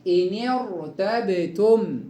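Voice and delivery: إن ارتبتم slowly, sakin ra thick after the kasra; heavy ra. Shown as a demonstration. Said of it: "النون مكسورة كسر عارض".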